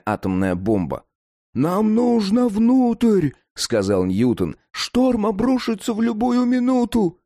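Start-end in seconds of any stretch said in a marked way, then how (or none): 1.5-3.3 high, loud, slow urgent plea. Acted. Boys panic in a storm. 4.7-7.2 high urgent warning. Acted. Boys panic in a storm.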